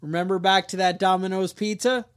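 ordinary delivery

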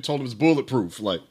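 ordinary talk

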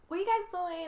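high, light girlish mimicry at normal volume; really high-pitched voice. Retelling how the girl interrupted the meditation, high-pitched imitation. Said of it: "it's, like, really high-pitched voice".

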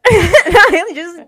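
real laughter, loud at first; laughing hard. Real, not acted. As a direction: crack up while telling it. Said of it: "I was literally dying".

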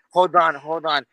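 ordinary talk, nothing marked

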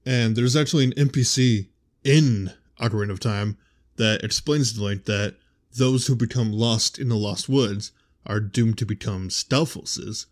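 ordinary talk, nothing marked

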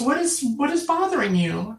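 a lightly voiced anxious mother; concerned mom. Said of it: "she goes".

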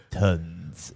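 a low, soft French "Tons"; French accent. Retold or imitated. Guy in French theatre answers mom, light French accent. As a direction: mumble "Tons" like a French moviegoer. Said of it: "in a French accent".

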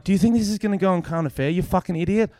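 fast, high-pitched indignant outburst; retold anger. Comedian challenges woman filming him, retold indignant anger. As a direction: re-enact an exasperated telling-off. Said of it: "I was so angry".

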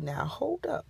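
a startled secret whisper. Retold or imitated. To herself, hearing the speaker connect: whispered.

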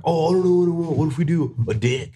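high, put-on dopey customer voice; mocking idiot customer. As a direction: ham up the clueless joker customer. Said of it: "he was being an idiot".

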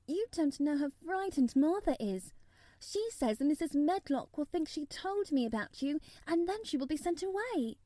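put-on lifted child's voice, worried; Mary, concerned.

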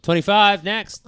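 cashier's loud, high call for the next customer. Pretend. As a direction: call it out across the checkout queue. Playing cashier calling the next number.

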